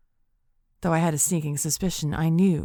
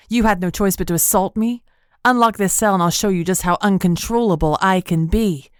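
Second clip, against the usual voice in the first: louder, defiant threat; furious shout; 2 semitones higher, 5 dB louder than usual. Acted. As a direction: shout it through the bars. Furious prisoner threatens captor.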